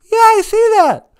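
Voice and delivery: very high, loud sugary voice; high mock cohost voice; sweet cohost voice. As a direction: chirp back like a bubbly cohost.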